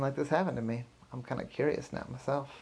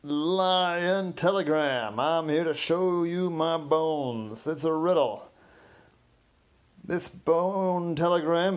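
loud, slow hammed-up lion performer; singing telegram guy hamming it up as a lion; 3 semitones higher, 5 dB louder, much slower than usual. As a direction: roar the riddle like a costumed lion. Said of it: "And they said".

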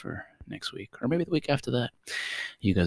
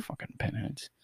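muttered insult; 6 semitones higher, 11 dB quieter, slower than usual. Real, not acted.

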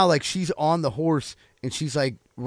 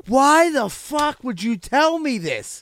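high, slow, drawn-out disbelieving complaint; hammed-up exasperation at the spoiler; mock outrage; 11 semitones higher, 4 dB louder, much slower than usual. Retelling to friends how his girlfriend spoiled the show, mock outraged.

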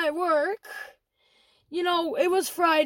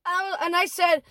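ordinary delivery

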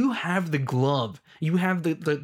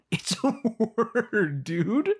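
high, drawn-out, laughing disbelief; incredulous mocking; 8 semitones higher, much slower than usual. Real, not acted. Fan mocking the fuss over one word, incredulous. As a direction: stretch it out high, half laughing.

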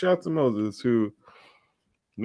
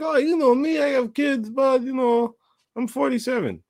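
put-on much higher Russian-accented boast; 13 semitones higher than usual. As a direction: brag about age in a Russian accent. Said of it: "he's like".

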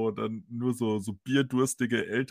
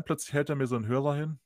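ordinary talk, nothing marked

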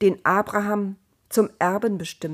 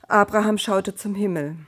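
usual speaking voice

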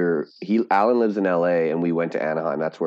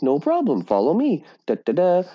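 high, bubbly theme-park greeter voice; cheery cast member; 8 semitones higher than usual. Retold or imitated. Retold Disney worker greeting guests brightly. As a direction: sell the magic, sweet and high. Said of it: "She's like".

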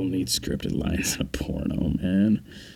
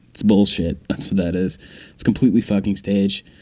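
ordinary talk, nothing marked

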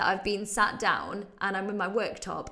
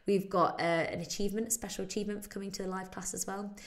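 usual speaking voice

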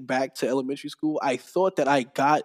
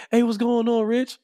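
cheery greeting; 10 semitones higher, 5 dB louder than usual. Retold or imitated.

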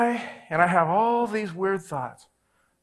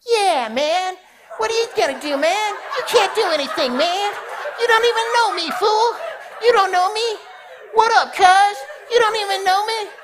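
high, loud, laughing gangster voice; a little guy mocking from behind, hammed-up gangster; 1940s gangster taunt; 6 dB louder than usual. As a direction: taunt like a cocky old-movie gangster. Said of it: "out of a nineteen forties gangster movie".

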